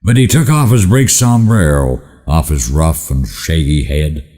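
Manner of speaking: ordinary delivery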